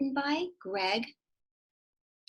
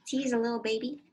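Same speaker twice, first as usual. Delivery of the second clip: ordinary talk, nothing marked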